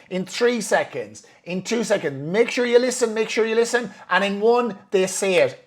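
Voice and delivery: high, insistent countdown as retold; urgent countdown. Coach counting down to the client's inner child, insistent.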